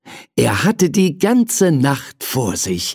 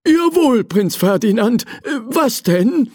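high, willing servant voice; a servant ready to help; eager servant; 10 semitones higher than usual. A narrator's reading. Hugo answers the prince, high and eager. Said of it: "erwiderte Hugo".

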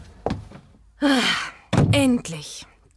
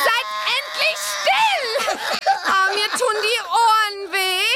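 higher and drawn-out, slow "Seid endlich still!"; fed-up shout; 5 semitones higher than usual. Acted. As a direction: shout in despair over the crying. Babies screaming, Bibi fed up. (verzweifelt gebrüllt)

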